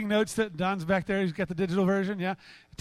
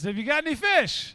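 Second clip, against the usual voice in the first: raised, higher-pitched shout to a boat; calling out; 5 semitones higher, 4 dB louder than usual. Acted. Man on shore asks fishermen, calling out. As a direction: call the question across the water.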